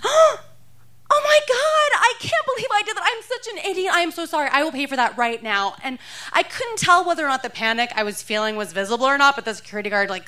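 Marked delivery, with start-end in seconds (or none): 0.0-5.8 much higher, gushing mock horror; fake shocked apology. Shoplifter feigning surprise to the security guard, much higher and gushing. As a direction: overplay the shock and sorry.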